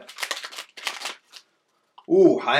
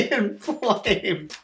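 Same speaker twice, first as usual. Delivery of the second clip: high laugh breaking into the word; 11 semitones higher, 4 dB louder than usual. Real, not acted. Laughing through crude confession.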